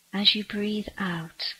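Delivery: ordinary delivery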